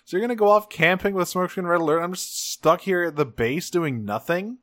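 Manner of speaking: high-pitched, incredulous complaining; mock being extremely pissed at being left out; imitated indignant outrage. Alexis learns she is left out of camping, indignant. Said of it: "she is extremely pissed".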